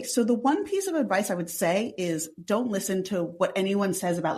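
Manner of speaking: normal talking voice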